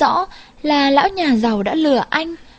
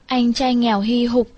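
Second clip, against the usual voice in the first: usual speaking voice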